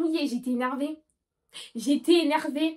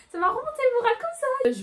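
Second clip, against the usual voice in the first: happy squeal; 13 semitones higher, 4 dB louder than usual. Real, not acted. Happy lifted mood.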